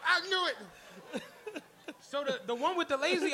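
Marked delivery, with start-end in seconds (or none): 0.0-1.2 triumphant gloat. Real, not acted. Gloating that he was fronting.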